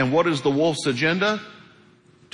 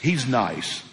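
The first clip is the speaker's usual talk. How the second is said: ordinary delivery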